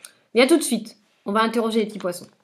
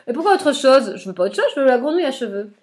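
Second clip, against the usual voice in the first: louder, stubborn raised voice; firm insistence; 4 semitones higher, 6 dB louder than usual. Acted. Boy holding firm against the witch, louder and stubborn. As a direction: dig in and insist.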